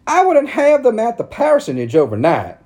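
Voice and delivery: high, loud indignant church lady; bristling, ready to spit; spiteful preacher's wife. A narrator's reading. Narrator voicing Missus Breeze refusing shelter, high and spiteful. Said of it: "about to spit".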